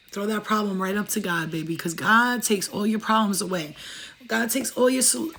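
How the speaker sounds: ordinary delivery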